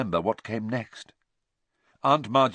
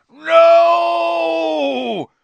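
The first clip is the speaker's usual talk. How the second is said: very high, loud, long-held cry; anguished scream; 10 dB louder than usual. A narrator's reading. Uncle watching sister rise to ceiling, screaming. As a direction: wail it out in horror.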